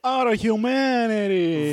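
slow, high Italian drawl; Italian accent. Acted. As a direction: mourn it in Italian. Italian bit.

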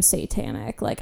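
low, soft hammed-up devil voice; mock demonic voice. Calls herself satanic when hungry.

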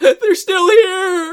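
high, very slow drawn-out wail; hammed-up terrified cry; mock horror. Grandma's horror retold.

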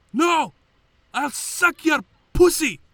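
very high, very loud shriek; furious shriek at the cabbie; screaming retort. Retold or imitated. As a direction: scream it back with everything. Screaming insult in quarrel.

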